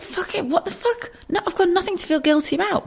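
high, quiet and fast, brushing off the guilt; defiant inner voice. Retold or imitated. Mother brushing off guilt about time alone, high and fast. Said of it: "But then I thought".

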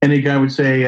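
ordinary talk, nothing marked

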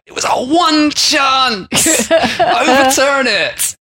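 high, slow, loud begging; desperate pleading. Pretend. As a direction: wail it like a last chance. Martinez court begging desperately.

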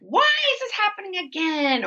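exaggerated woe-is-me protest; self-pitying complaint. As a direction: wail it high, hamming up the self-pity. Acts out self-pitying human nature.

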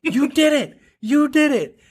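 high, cheering praise; excited yell. Retold or imitated. Parent cheering toddler on the potty, high excited cheering. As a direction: cheer like your toddler just did it. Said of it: "I'm yelling".

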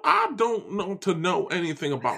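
blustery, swinging Southern cartoon voice; hammed-up Southern rant; Southern gentleman. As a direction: lecture her like an indignant Southern gentleman. Keeps up Foghorn voice.

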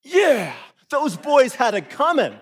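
loud, high smug glee with a laugh; gleeful approval. Retold or imitated. A listener who thinks they deserved it, gleeful. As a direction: cheer the punishment mockingly. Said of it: "one possible reaction is".

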